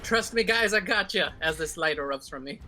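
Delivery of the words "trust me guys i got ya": higher, slightly raised rallying call; half-volume heroic shout; acted yell. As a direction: call out reassurance to fallen friends. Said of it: "So I'm just gonna yell out".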